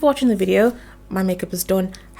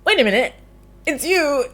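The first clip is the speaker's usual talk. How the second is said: giant recognizing you; 6 semitones higher than usual. Retold or imitated. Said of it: "He's just like".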